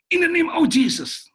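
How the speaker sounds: high, drawn-out shouted invocation; re-enacted battle cry of faith; commanding shout. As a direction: cry it out slowly as a command against attackers. Retold command to armed robbers.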